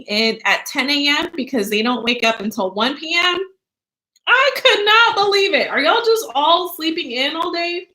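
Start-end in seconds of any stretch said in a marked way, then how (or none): 4.3-7.9 disbelief. Real, not acted. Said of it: "amazed at students sleeping".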